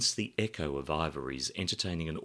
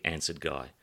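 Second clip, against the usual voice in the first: ordinary delivery, nothing marked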